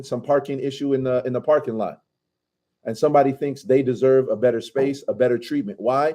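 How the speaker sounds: ordinary talk, nothing marked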